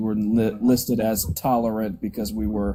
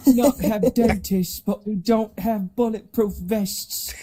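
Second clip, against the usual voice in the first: mock British accent; 8 semitones higher, slower than usual. Shown as a demonstration. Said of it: "mocking British accents".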